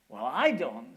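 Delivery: loud, low devil retort; mock devil retort. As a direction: een gespeelde duivel, luid en laag.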